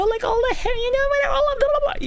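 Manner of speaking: mock squeaky voice pitched far too high; cartoon voice. Shown as a demonstration. Showing viewers the wrong way, mock cartoonish high squeak. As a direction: overdo it like a cartoon girl. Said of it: "you're not being a fucking cartoon character".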